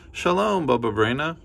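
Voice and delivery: high bright call of shalom; greeting neighbors. Acted. Neighbors at the door greeting granny, bright. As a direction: sing out a hello.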